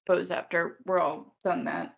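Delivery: ordinary delivery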